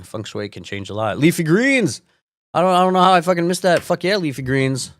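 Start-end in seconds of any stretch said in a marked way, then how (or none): 1.2-4.9 shouted, much higher and fast; sudden excited outburst, half sarcastic. Real, not acted. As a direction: burst out as if you just remembered. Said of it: "sudden excited remembering".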